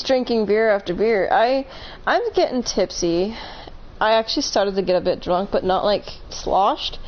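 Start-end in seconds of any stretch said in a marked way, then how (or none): none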